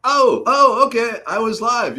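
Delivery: real startled delight; surprised realization. Real, not acted. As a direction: caught off guard, then it clicks. Realizing viewer was live there.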